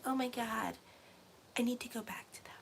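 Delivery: breathy whisper, dropping to nothing; mock whispered longing. Reader mocking a client who craves an ex, whispered. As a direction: sneak the words out like a guilty secret. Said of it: "it's like".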